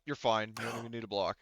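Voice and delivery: ordinary talk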